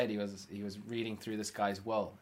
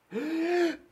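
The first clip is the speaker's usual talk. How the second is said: high, sharp gasp for air; acted gasp; 5 dB louder than usual. Retelling talk with a smelly customer, desperate mock gasp. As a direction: gasp as if finally free to breathe. Acts out gasping after holding breath.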